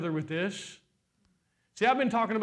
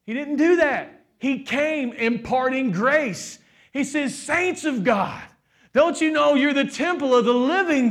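full-force preaching; 5 semitones higher, 5 dB louder than usual. Acted. Pastor preaching to the congregation, full force. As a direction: preach it hard to the church, drawing each word out.

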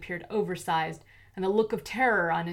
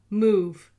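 loud, clipped command; villain orders Lara at gunpoint; curt order; 2 semitones higher, 8 dB louder than usual. A narrator's reading. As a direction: snap it out.

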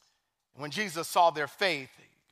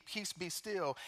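Jesus rebuking the sea, softly; soft rebuke; 13 dB quieter, slower than usual. Acted. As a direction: quietly still the sea. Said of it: "rebuked the wind and said to the sea".

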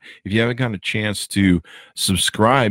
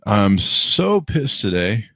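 usual speaking voice

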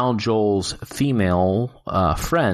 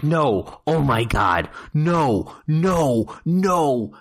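mild mock yell, each no climbing higher; retold yelling at the TV; 5 semitones higher, much slower than usual. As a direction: replay the protest at the screen, half-acted. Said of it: "yelling at my television".

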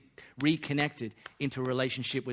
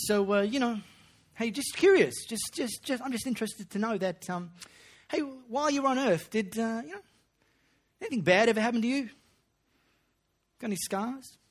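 higher, nosy, leading questions; sly curious Paul; 9 semitones higher, 3 dB louder than usual. Pretend. Paul probing the preacher about his scars, higher and sly. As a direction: fish for a confession with fake innocence.

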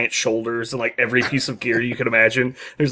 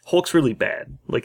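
ordinary talk, nothing marked

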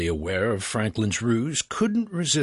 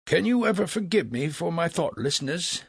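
lightly voiced apologetic philosopher, pitched up; flattering Franklin; 8 semitones higher than usual. Franklin asks Legras's forgiveness, theatrically contrite. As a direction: beg pardon with mock humility.

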